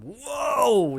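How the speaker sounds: high, loud amazed wow; a big wow, as if your head just burst; mind-blown wow. Retold or imitated. Retelling his reaction to the fight scene, mind-blown wow. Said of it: "ma tête a fait comme".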